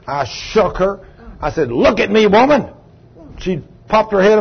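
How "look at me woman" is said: raised, higher commanding call; preacher barking a command at the possessed woman; stern command. Retold or imitated. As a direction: order her to look at you, sharply.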